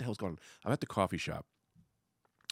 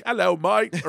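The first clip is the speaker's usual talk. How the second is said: mock English accent; 7 semitones higher, 8 dB louder, slower than usual. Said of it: "fake English accent".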